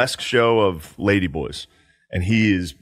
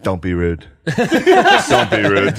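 laughing through it; 3 semitones lower, 4 dB louder, much slower than usual. Retold or imitated. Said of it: "And he's like".

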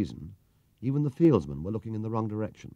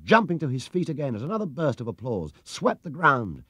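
ordinary delivery, nothing marked

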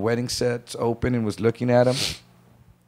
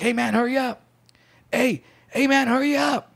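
much higher, pressing call to the back; urgent hurry-up; 12 semitones higher than usual. Retold or imitated. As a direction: hurry your partner, tense. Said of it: "And Freddie's like".

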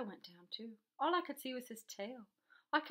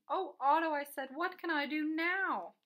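louder, higher, drawn-out exclamation; helpless, loud plea; dismayed cry; 5 semitones higher, 7 dB louder than usual. A narrator's reading. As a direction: wail it in dismay, stretching the words. Boy exclaims as fish outgrows bath.

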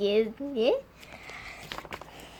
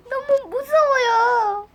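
frightened whimper; 9 semitones higher, slower than usual. Acted. Scared patient.

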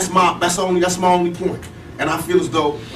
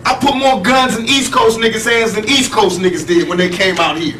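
heated rant; 5 semitones higher than usual. Real, not acted. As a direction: vent hard, voice raised high.

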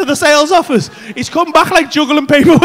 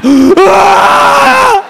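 loud, high mock scream; long hammed-up wail of despair; horrified scream; 7 semitones higher, 10 dB louder than usual. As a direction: scream in comic horror. Acts out horror at lockdown.

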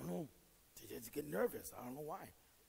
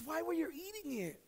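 surprised wife; 11 semitones higher, 5 dB louder than usual. Retold or imitated. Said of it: "She goes".

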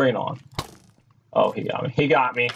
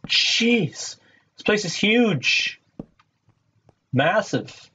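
amazed; 9 semitones higher, much slower than usual. Real, not acted. Amazed at huge cave.

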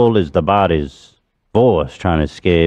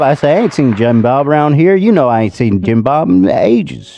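put-on Southern accent. Hosts asking the accent coach, Southern accent monologue. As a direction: tell the murder tale in a Southern accent, unhurried. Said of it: "what did you think about my accent?".

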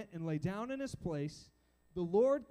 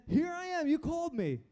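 high-pitched, eager announcement; eager young Samuel; 7 semitones higher, 3 dB louder than usual. Acted. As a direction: answer a summons brightly, pitched way up. Samuel running to Eli once more.

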